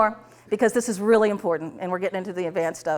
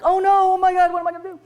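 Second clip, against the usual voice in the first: high, loud flustered wail; hammed-up helpless alarm; mock panic; 10 semitones higher, 6 dB louder, faster than usual. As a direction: panic in an exaggerated, pitched-up voice. Acts out the listener's imagined panic.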